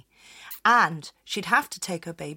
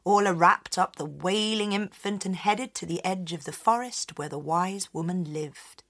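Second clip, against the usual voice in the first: usual speaking voice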